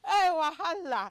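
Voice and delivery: loud drawn-out wahala, hammed up; mock loud exclaim. Host teasing co-host about future abroad, loud mock exclaim. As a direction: boom it out slowly like a mock warning.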